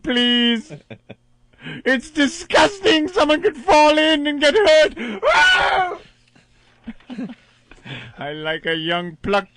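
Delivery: campy shrieking melodramatic ham; hammy stage actor. Pretend. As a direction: overact every line like a coward. Continuing Doctor Smith parody.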